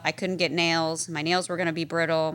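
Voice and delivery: ordinary talk, nothing marked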